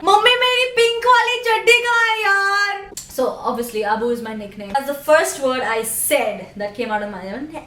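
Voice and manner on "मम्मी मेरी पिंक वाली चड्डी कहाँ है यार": put-on fast, high, louder child calling mom; कपड़े ढूँढता बच्चा, तेज़ ऊँची आवाज़ में; whiny kid to mom. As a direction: rattle it off like an impatient kid.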